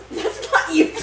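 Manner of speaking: high, drawn-out laughing protest; laughing in disbelief; incredulous laughter. Real, not acted. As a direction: crack up while correcting a friend. Friend amused at wrong state guess.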